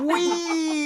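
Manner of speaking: high playful coaster wee; on a roller coaster. Pretend. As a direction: squeal as if riding downhill. Pretend roller coaster again.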